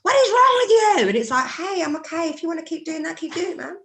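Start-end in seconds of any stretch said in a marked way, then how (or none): 0.0-1.1 high, loud mocking cry; mock outrage. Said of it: "it's actually the other cult". 1.5-3.8 high, soft, easygoing voice; light, placating acted reply; laid-back reply. Acts out laid-back reply to accusers.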